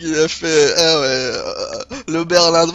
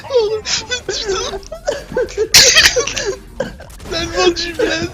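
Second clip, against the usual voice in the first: crying with laughter. Real, not acted.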